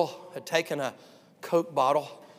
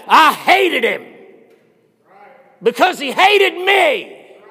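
loud, high, slow hateful shout; drawn-out furious shout; hate-filled roar; 12 semitones higher, 16 dB louder, much slower than usual. Retold or imitated. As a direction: voice the Lord's fury. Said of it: "Lord, what'd you think about it".

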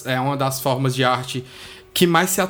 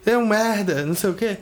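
higher-pitched imitation of indignant griping; mock pissed-off rant; 7 semitones higher than usual. Podcaster imitating his old reaction to rival creators, mock outrage. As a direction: act out your old annoyed self. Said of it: "eu antes eu ficava pistola".

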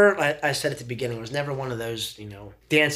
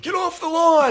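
put-on high, loud grumpy old-man yell; sudden mock-cranky shout, pitched way up; crotchety old man; 6 dB louder than usual. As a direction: holler like a cynical old crank. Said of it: "becoming that crotchety, cynical old man".

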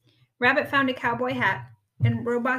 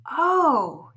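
loud, high gasp of realization; 5 semitones higher, 10 dB louder than usual. A narrator's reading. Rabbit spotting words on the buttons, gasping. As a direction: gasp as it dawns. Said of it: "he gasped".

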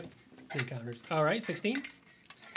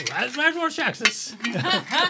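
high, drawn-out name call; grand introduction, stretched and pitched up; herald's announcement; 10 semitones higher, 4 dB louder, much slower than usual. Acted. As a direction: slowly proclaim the guest's arrival. Herald announces next guest's entrance.

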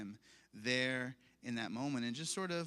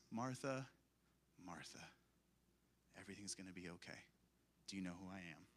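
tender hush; 7 semitones lower, 13 dB quieter, faster than usual. Acted. Jesus calming spiraling Martha, hushed and tender. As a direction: soothe a frantic friend gently. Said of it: "he says".

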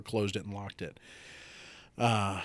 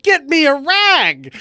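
slow, high, very loud yell; full-volume re-enacted demand; exasperated yell; 16 dB louder, slower than usual. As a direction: bellow each word in exasperation. Retelling final exasperated demand.

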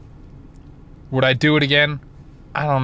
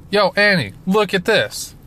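calling out; 7 semitones higher than usual. Retold or imitated. Calling out to Annie.

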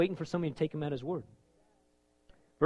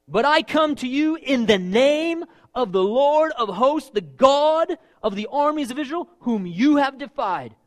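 high, loud, slow proclamation; preaching at full force; 9 semitones higher, 8 dB louder than usual. Acted. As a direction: hurl it back at the giant. Preaching David's reply.

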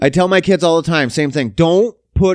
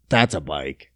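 very low, muted delivery; low mock-dread murmur; sinking realization; 13 semitones lower, 4 dB quieter than usual. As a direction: drop way down in pitch, acting it out.

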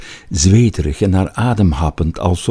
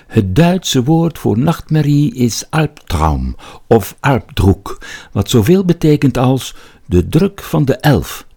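lightly voiced literature professor, higher pitch; a hint of a lecturing professor, pitched up; professor's voice; 6 semitones higher than usual. A narrator's reading. Recalls his professor's etymology lesson.

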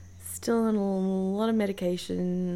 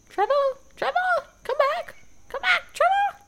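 put-on high, loud, frantic calling after a child; nervous mother; 8 dB louder, faster than usual. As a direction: shriek after a runaway kid, very high. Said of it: "Sounding like a nervous mother calling after their child".

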